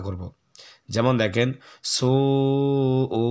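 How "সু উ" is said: "সু" stretched into a long nasal "উ"; madd and ghunna. Shown as a demonstration. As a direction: hold both vowels, humming the second. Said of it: "দুইটাকে আমরা একত্রে মিলে গুণনা".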